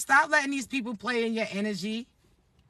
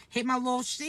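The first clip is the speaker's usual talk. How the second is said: normal talking voice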